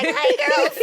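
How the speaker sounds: excited squeal. Retold or imitated. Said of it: "I'm like".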